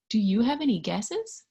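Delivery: ordinary delivery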